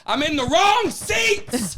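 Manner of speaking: slow, high, loud wail of dismay; hammed-up yell, stretching every word; exasperated yell. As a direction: cry it out in drawn-out exasperation. Climax of his travel mishap story.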